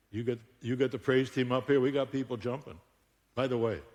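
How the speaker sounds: usual speaking voice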